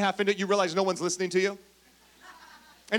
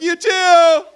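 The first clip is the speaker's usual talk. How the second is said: awkward reply; 8 semitones higher, 13 dB louder than usual. Retold or imitated. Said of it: "And you're like".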